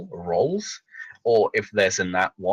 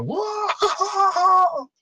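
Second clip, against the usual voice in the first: a far higher crowd-style whoa; fake audience whoa. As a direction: sell a cheering crowd. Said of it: "pretend that there's an audience clapping and go".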